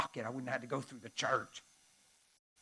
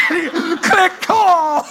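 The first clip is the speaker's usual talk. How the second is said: high, loud laughing exclamation; shouting through laughter; 12 semitones higher, 18 dB louder, much slower than usual. Real, not acted. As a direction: laugh out the punchline. Laughing at the twist ending.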